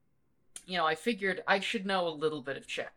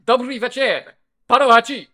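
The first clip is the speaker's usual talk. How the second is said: loud, high Czech phrase, proudly proclaimed; proud Czech party trick; 6 semitones higher, 13 dB louder than usual. Shown as a demonstration. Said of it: "and it is".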